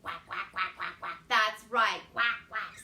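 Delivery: mock duck call; ducks quacking. A narrator's reading. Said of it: "said the ducks".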